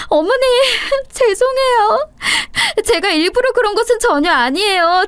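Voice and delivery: daughter-in-law kneeling and begging her mother-in-law. Acted. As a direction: beg desperately for forgiveness.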